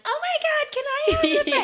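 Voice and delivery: squeaky, very high, laughing order voice; high-pitched voice. Retold or imitated. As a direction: jump way up into a squeak. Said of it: "Why did my voice go up two octaves?".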